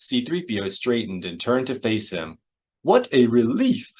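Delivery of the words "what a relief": high, relieved droid exclamation; C-3PO exclaiming. A narrator's reading. Droid turns to face Han, exclaiming in relief. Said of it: "he exclaimed".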